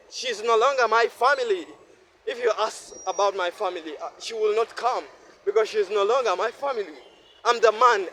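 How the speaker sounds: usual speaking voice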